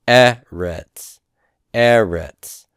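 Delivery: "eretz" with the first syllable stressed, falling on the second; non-final stress. Shown as a demonstration. Teacher showing which vowel is stressed, first syllable stressed in "eretz". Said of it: "is being stressed".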